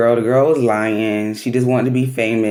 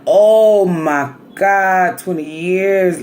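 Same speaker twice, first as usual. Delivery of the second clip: slow, stunned, higher and louder "oh my God"; shocked gasp; 6 semitones higher, 4 dB louder, much slower than usual. Retold or imitated. Courtroom crowd reacting to twenty years, stunned. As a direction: drag it out in disbelief.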